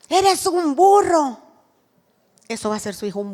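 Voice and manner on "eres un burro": mock insult. Parent insulting child.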